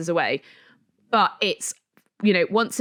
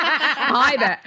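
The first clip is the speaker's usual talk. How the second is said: put-on American accent; 6 semitones higher, 3 dB louder than usual. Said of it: "I'm gonna do it".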